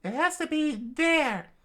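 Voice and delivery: slow, loud falsetto; high-pitched emphatic certainty; insistent princess. Acted. Vivi insists face to face.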